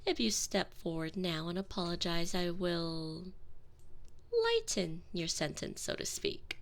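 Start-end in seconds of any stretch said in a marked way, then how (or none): none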